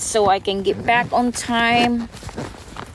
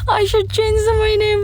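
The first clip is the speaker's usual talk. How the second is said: high, laughing delivery of the line; laughing through words; 10 semitones higher than usual. Real, not acted. Repeating the viewer's joke, laughing as she speaks. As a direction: squeeze the words out while laughing. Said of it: "you making me laugh".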